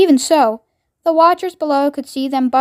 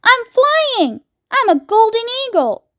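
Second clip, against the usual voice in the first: high squealing delight; thrilled; 10 semitones higher than usual. A narrator's reading. Grandma Josephine crowing to everyone that she flies, very high. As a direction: soar with joy like a child.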